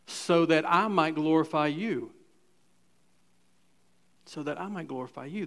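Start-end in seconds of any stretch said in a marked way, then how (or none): 4.3-5.5 softer and faster, half to himself; a murmured, hurried repeat of the verse; quick quiet aside. Shown as a demonstration. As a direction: toss it off under the breath, quicker.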